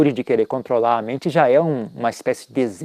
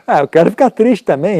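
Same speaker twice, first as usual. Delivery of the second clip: mock sulky whine; 5 semitones higher, 7 dB louder than usual. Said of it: "Aí eu também queria ficar".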